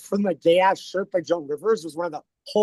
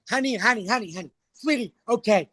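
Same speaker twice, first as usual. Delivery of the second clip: higher-pitched roasting comedienne; Joan Rivers impression; 5 semitones higher than usual. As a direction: rattle off a mock read, honey first. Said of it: "Joan was like".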